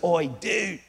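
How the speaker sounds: hushed, slow Australian aside; soft conspiratorial Aussie, vowels stretched. Acted. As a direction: mutter it slowly in a broad Aussie accent. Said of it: "he goes".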